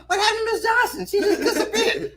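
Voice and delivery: very high mock-innocent voice; feigned innocence. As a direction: ask it in a squeaky-high, innocent voice with a laugh in it. Teller voices himself feigning innocence.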